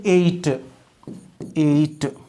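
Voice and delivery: "एट" to rhyme with "late"; verb "-ate" ending. Shown as a demonstration. Teacher shows the verb ending, "एट" with long vowel. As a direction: say "एट" with a long "ay" and crisp T. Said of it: "एटीई का pronunciation फिर करेंगे".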